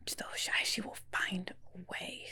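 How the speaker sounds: secretive whispered aside. Real, not acted.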